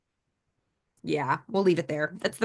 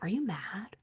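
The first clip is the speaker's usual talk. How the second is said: quiet mock question; 10 dB quieter than usual. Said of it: "people, and they're like".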